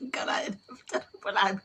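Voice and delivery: words lost in laughter; real giggly speech; laughing. Real, not acted. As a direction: talk through a laugh. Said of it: "I am laughing".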